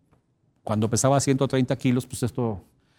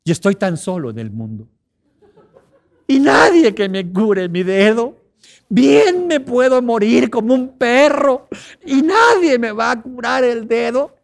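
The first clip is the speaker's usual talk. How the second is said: high, loud, slow melodramatic wailing; milk the self-pity like a soap opera; hammed-up sobbing about a sore finger; self-pitying lament; 7 semitones higher, 7 dB louder than usual.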